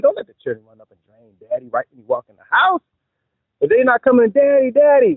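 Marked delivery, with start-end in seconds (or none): 4.3-5.1 put-on excited kids. Kids greeting dad.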